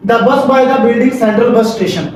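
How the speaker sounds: slow, even, no pauses or stress; smooth in one flow. Shown as a demonstration. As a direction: say it in one clear, unbroken line. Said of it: "रुका नहीं हूं, कोई पोज नहीं लिया". (बिना रुके एक लय में साफ़ बोलना)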